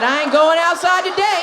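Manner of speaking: mock neighbour. Imitating neighbour bad hair day.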